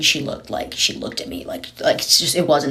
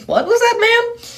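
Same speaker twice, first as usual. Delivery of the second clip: much higher, sweet customer-service voice; high polite ask; 4 dB louder than usual. Retold or imitated. Speaker asks the mumbling woman to repeat, in a high polite voice. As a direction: chirp it up high like a polite clerk. Asking stranger to repeat herself.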